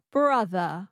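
transatlantic 'brother' with the R dropped; transatlantic accent. Shown as a demonstration. Student repeating after the coach, transatlantic accent. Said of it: "you drop the R and you make it a schwa".

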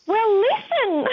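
put-on slow, very high little-girl voice, matter-of-fact; a toddler patiently explaining to Nana; toddler's voice. Blind grandmother voicing granddaughter, slow high child voice.

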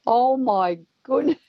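high, drawn-out astonished exclamation; retold amazement. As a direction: stretch it out in disbelief, replaying the shock. Retold amazement at pelican's theft.

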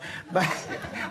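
unvoiced chuckle of amusement; breathy laugh. Real, not acted. Laughs at own confession.

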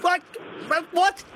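excited. Real, not acted. Host waiting on caller's answer.

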